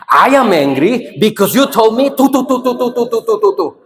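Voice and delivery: high-pitched acted tirade running into rattled syllables; mock anger. Shown as a demonstration. Teacher leading the group in one voice, mock angry. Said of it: "Really put it".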